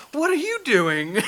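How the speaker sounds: a hint of a curious little boy, said through a laugh; imitating the kid. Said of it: "he's like".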